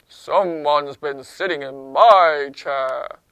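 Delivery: loud, slow, low papa bear; big bear voice, leaning on MY; low-voiced Daddy Bear. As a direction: boom it out down low. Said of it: "in his low voice".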